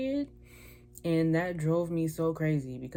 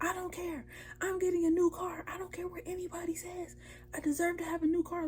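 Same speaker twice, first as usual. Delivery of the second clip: much higher, mock-screamed rant, fading to a hiss midway; retold car rant; 13 semitones higher, 4 dB quieter than usual. Raging alone in her Honda, high mock scream. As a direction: relive yelling at the old car, pitched way up. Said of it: "I was screaming".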